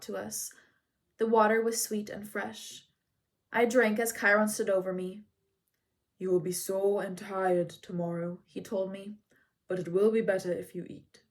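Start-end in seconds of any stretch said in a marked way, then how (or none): 6.2-11.2 lightly voiced wise centaur; Chiron's voice. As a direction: speak as the calm old centaur. Chiron speaks to the boy.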